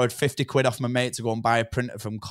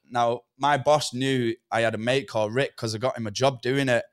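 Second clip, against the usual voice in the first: usual speaking voice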